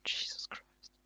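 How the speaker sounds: breathy under-the-breath whisper. Real, not acted. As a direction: mutter it in a whisper. Reacting to gushing recommendation.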